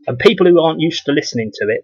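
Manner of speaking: ordinary delivery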